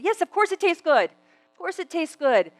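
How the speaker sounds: high, loud, emphatic retort; exasperated agreement. Acted. Speaker answering those who praise the taste, exasperated. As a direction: brush the gushing aside, high and emphatic.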